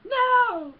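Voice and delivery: very high, loud drawn-out no; hammed-up alarmed cry; mock droid outcry. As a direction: wail it like a panicking robot.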